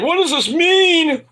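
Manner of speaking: high, drawn-out mock wail; mock upset. Apollo upset about his visions, high mock wail. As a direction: stretch it out high, mocking his distress. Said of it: "He's all upset about the visions".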